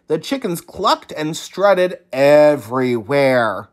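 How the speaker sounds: usual speaking voice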